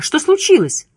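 high-pitched anxious question; worried woman, voice lifted; Liza's distant cry. A narrator's reading. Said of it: "повторяла она".

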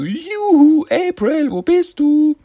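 very high, loud, shrill calling out; shrill Irma voice. Retold or imitated. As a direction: shriek her name sweetly across the sewers. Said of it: "die schrille Stimme von Irma".

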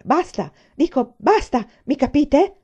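loud, slow, emphatic order to stop; indignant scolding. A narrator's reading. Teacher orders the soldier out of line, indignant. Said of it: "disse con cipiglio".

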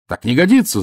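high, loud protest; enraged prisoner refusing to wait; angry shout. Acted. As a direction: yell it in fury. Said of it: "закричал я".

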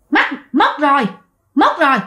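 lightly voiced eerie possessed woman; a hint of a spirit gloating over a death. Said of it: "bà Sáu vừa cười vừa nói". (bà Sáu bị vong nhập nói nhẹ nhàng kiểu ma quái)

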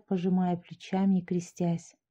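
usual speaking voice

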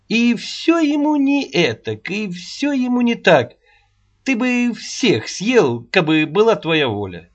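put-on high, nagging old woman's voice; scolding old woman. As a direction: scold him like a cross old nanny. Аннушка scolds Рогачёв.

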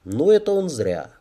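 usual speaking voice

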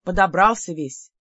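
plain reading voice

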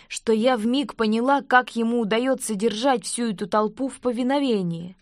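ordinary delivery, nothing marked

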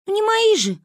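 put-on high, snorting little-girl retort; Манюня. A narrator's reading. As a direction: scoff in a squeaky child's voice. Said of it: "фыркнула Манюня".

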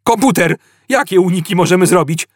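high, rushed appeal to the computer; panicky. A narrator's reading. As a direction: fire the question off in panic. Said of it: "słychać było nagłą panikę".

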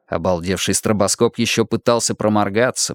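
ordinary delivery

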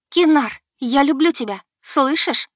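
loud, high desperate call. A narrator's reading. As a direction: call across the night. Girl calls out to dragon. (громкий отчаянный зов)

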